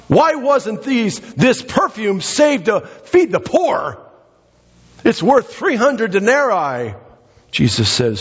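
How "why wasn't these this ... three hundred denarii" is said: high, slow, sanctimonious complaint; mock pious Judas. Preacher imitating Judas scolding over wasted perfume, slow and pious. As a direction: drag it out like a self-righteous hypocrite. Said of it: "He starts speaking religion".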